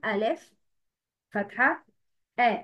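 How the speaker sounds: "ألف", "فتحة", "آ" as three separate beats; spelling by tongue. Shown as a demonstration. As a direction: pause between letter "ألف", vowel "فتحة" and sound "آ". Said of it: "ده كده تهجي لسان". (تهجي "ألف فتحة آ" مع وقفات)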